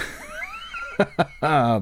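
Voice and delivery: squealing burst of laughter; high-pitched laugh. Real, not acted. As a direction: shriek with laughter. Big laugh at the clip.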